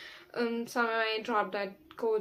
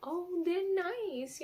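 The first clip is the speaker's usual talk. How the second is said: higher, slow and sweet; naive gullible self; 8 semitones higher, much slower than usual. Retold or imitated. Mocking her past trusting self, in a sweet slow naive voice. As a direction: coo it innocently. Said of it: "but I'd still be like".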